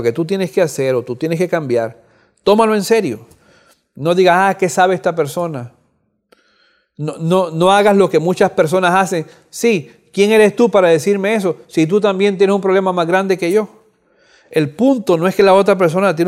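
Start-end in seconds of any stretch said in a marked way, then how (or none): none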